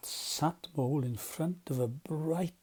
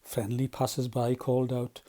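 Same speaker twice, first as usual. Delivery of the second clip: ordinary delivery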